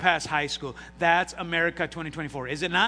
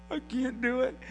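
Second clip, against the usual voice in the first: put-on little boy crying; 8 semitones higher than usual. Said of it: "he's standing there and he's crying".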